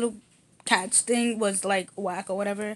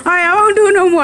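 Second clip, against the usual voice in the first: loud, giggling promise; laughing promise; 9 semitones higher, 16 dB louder, much faster than usual. Real, not acted. Promising her twin to stop, laughing.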